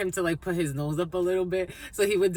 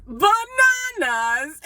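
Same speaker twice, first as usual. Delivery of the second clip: very high, loud, stretched-out mock squeal; hammed-up British-style echo, bursting into laughter; delighted echo; 9 dB louder, much slower than usual. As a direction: draw the word out in giddy delight. Retells her delighted echo of him.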